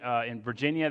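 usual speaking voice

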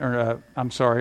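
usual speaking voice

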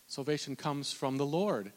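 usual speaking voice

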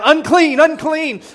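called out high, as a warning; mock leper cry. Leper warning passersby from a distance, high called-out cry. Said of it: "they have to cry out".

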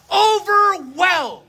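"overwhelmed" slow, loud and high; overwhelmed stretched. Acted. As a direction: draw "overwhelmed" out in a loud, rising cry. Quoting David's cry.

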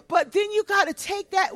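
ordinary talk, nothing marked

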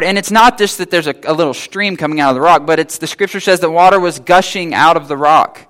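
normal talking voice

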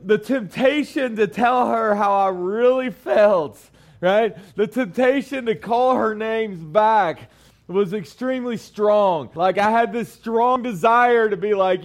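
ordinary talk, nothing marked

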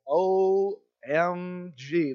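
very slow, letter by letter; stunned dismay. Retold or imitated. Kids realise they can't see shore, stunned and slow. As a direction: drag out each letter in dawning dread. Stranded far out at sea.

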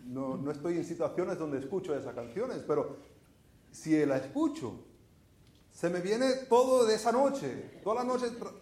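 normal talking voice

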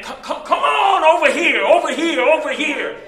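high, loud barker call; calling from far away. Retold or imitated. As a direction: beckon the crowd like a barker. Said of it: "phrases that they would use".

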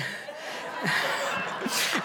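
airy unvoiced giggle; a quick, sheepish, breathless laugh; breathy laugh. Real, not acted. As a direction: laugh at your own softness. Laughing at being a sucker.

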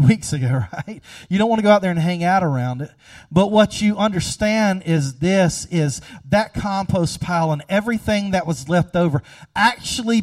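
fiery sermon climax; preaching at full force. Acted. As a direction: drive it home from the pulpit.

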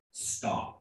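soft, breathy, deadly serious stop; dad quietly warning son to stop, no wiggle room. Retold or imitated. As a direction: hush it out as a final parental warning. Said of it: "that's a little bit more serious".

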